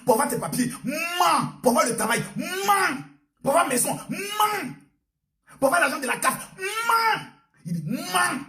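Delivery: rising, shouted sermon refrain; fiery preaching. Acted. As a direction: build the crowd with every mens. Preaching chant at full force.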